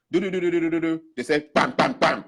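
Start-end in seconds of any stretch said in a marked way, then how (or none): 0.1-1.0 higher rapid "do-do-do" babble; rattled-off nonsense syllables. Acted. Court totting up the sums, rattled-off imitated noise. 1.5-2.2 three punchy spoken bangs; imitated gavel banging. Judge closing the case, imitated gavel bangs.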